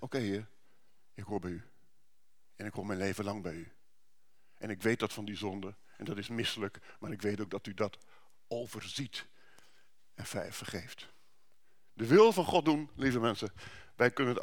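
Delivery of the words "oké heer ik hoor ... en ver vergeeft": soft, humble prayer; quiet prayer. Acted. Believer answering Jesus in soft prayer. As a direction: speak to the Lord gently.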